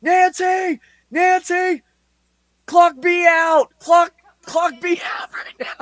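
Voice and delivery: screaming for help while holding someone back; frantic yell. Retold or imitated. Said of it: "she goes".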